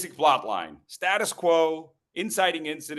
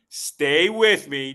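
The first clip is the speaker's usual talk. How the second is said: loud and drawn out slowly; slow emphatic; 8 dB louder, much slower than usual. Shown as a demonstration. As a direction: stretch each word out, pleading. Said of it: "repeated plea to audience".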